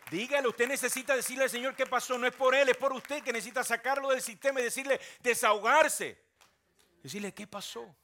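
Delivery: loud, high, impassioned sermon; fiery preaching. Acted. Pastor urging the congregation to pour out their hearts, shouting. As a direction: preach it out to the back row.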